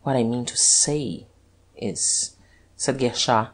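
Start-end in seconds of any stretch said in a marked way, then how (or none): 0.0-2.4 quiet faltering start; hesitant, broken phrasing; halting, uncertain. A narrator's reading. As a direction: trail off, searching for words. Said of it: "in a halting, uncertain voice".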